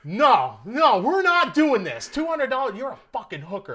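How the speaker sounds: loud, high refusal, easing off at the end; pissed off. Retold or imitated. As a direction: act out indignant refusal. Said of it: "I'm pissed".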